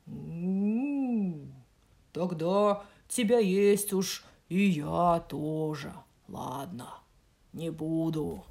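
slow eerie howling wolf; a wolf half-joking with menace. Acted. Wolf speaks. (воющий волк)

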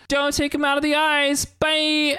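high sing-out goodbye voice; mock friendly farewell. Voicing a character's friendly goodbye.

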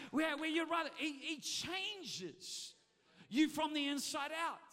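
loud, building pulpit shout over music; shouted preaching climax. Real, not acted. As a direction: lift the crowd to a roar.

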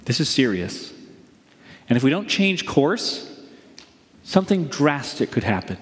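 grave, low-pitched doctor; warning doctor. Acted. Doctor who gives warnings to a patient, grave and low. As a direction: deliver serious news with measured pauses. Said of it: "The doctor who gives the warnings says".

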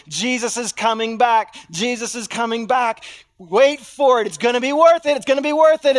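rally the crowd with the promise; eager proclaiming. Acted. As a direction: church shouting the good news. Early church acted proclaiming return.

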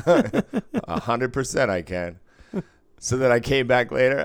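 ordinary delivery

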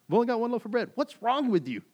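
loud, very high-pitched incredulous rant; frustrated Jesus. Acted. Jesus to his clueless disciples, frustrated. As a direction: cry out in total exasperation. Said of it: "That's Jesus in this moment to his disciples".

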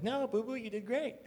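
high, soft imitation of a reassuring wife; consoling wife. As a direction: coo it sweetly in a put-on high voice. Retold wife consoling him.

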